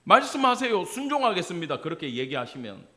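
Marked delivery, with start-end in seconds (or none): 0.0-1.8 high, eager "말씀하세요" then settling; eager little demo of a willing heart; bright eager response. Shown as a demonstration. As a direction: answer God keenly, pitch up. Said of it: "이렇게".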